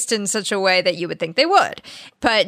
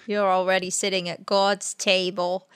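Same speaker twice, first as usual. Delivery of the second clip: usual speaking voice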